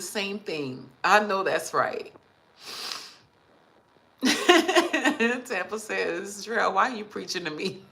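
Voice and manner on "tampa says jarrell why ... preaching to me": reading out through laughter; amused, words breaking out of a laugh. Real, not acted. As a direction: laugh as you read the teasing comment. Laughs while reading a viewer's comment.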